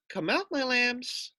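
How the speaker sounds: high and slow, calling gently; a mother calling her lambs out. Acted. The sheep calling her lambs from behind the rock, high and slow. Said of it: "Then she called".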